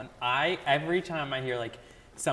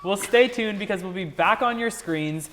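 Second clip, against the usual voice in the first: announcer voice; 5 semitones higher, 9 dB louder than usual. Acted. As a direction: pitch it up like a TV host. Hands off to next segment.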